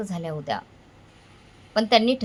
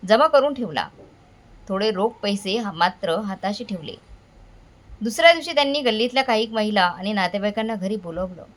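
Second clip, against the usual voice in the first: ordinary delivery, nothing marked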